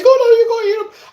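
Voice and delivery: very high, loud put-on voice; mock polite refusal. Imitating companions handing over food, high mock politeness. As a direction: mimic them in a loud falsetto. Acts out companions declining again.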